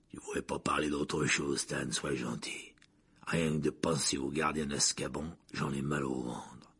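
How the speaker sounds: deep, hushed, slowing plea; shuddering dread. A narrator's reading. Driver begging the conductor to change subject, dread. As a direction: plead low and uneasy. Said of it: "secoué d'un frisson".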